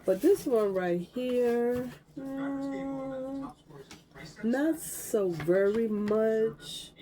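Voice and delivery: unimpressed. Real, not acted. Judging colours, disliking one.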